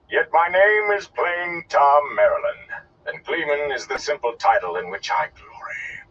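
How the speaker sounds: high, loud, slow proclamation; grand, stretched-out showman's boast; reverberating oration. Acted. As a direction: declaim it to a great hall. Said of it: "seemed to reverberate in a great hall".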